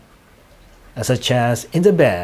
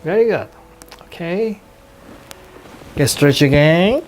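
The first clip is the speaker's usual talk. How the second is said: guided hypnosis. Acted. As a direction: guide gently, stretching out the key words.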